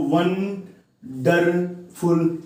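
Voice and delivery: Wonderful slowly in three beats; teacher sounding out wonderful piece by piece; split into syllables. Shown as a demonstration. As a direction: lengthen and separate each syllable of wonderful. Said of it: "इसमें three syllables हैं".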